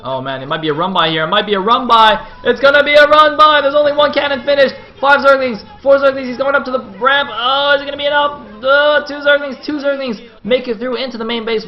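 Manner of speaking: building to a high, loud shout; excited play-by-play. Real, not acted. Caster calling a Zergling run-by, excited shouting. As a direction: yell it as the action explodes. Zerglings rush past the wall.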